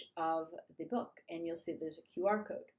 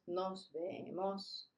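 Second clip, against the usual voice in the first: drawn out and softer; slow soft farewell; 6 dB quieter, much slower than usual. Shown as a demonstration. Teacher modeling a slow, soft goodbye. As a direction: model it gently. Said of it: "Let's try it again".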